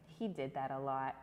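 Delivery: ordinary delivery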